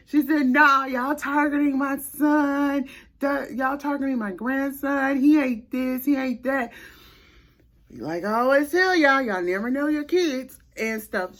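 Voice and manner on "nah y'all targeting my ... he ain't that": angry grandma. Retold or imitated. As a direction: act out a grandma insisting her grandson is innocent. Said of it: "She was so pissed".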